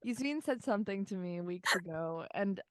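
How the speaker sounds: normal talking voice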